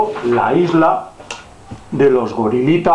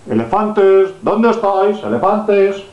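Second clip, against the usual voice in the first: calling out; 6 semitones higher, slower than usual. Pretend. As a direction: call out to the elephants. Calling out to the elephants.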